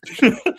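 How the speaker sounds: quick burst of laughter; a bright laugh breaks out; short high laugh. Real, not acted. Laughs at his own joke.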